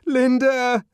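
very high, loud shout. A narrator's reading. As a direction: yell for her through the door. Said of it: "he shouted".

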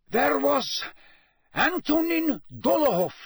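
high, hasty pleading; hurried, nervous. A narrator's reading. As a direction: rush to explain. Karkaroff nervous witness.

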